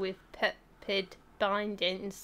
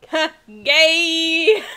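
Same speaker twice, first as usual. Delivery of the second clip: spirit voice; 5 semitones higher, 10 dB louder than usual. Acted. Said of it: "my spirit says".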